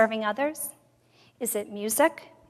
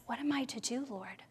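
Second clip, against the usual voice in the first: much softer, fading whisper; hushed plea; 15 dB quieter than usual. Retold or imitated. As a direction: murmur a helpless question to God. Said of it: "And Moses says".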